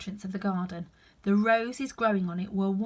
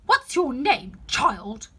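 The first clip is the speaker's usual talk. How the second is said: loud, higher haughty demand; imperious queen; 4 semitones higher, 9 dB louder, much slower than usual. Acted. Queen demands Alice's name, loud and haughty. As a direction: talk down to the child.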